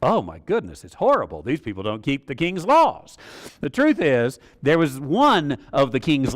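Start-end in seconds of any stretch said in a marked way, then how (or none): none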